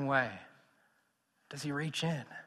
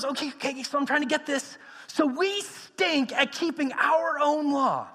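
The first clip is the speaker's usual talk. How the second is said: incredulous Peter; 7 semitones higher than usual. Acted. Peter summing up to the elders, higher and incredulous.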